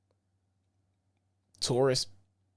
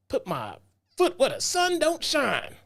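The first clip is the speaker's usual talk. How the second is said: a light mock of an old relative, jumping much higher; folksy old-school threat, half-playful; old-timey saying; 11 semitones higher than usual. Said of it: "old-timey sayings like that".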